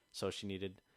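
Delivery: normal talking voice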